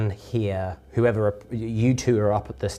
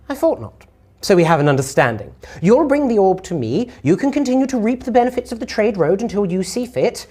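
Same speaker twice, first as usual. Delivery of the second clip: high, commanding lady; distorted female voice; 8 semitones higher, 4 dB louder than usual. Acted. Lady sets terms for the cowed Lorik, high and commanding. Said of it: "uh, female, but it sounds distorted".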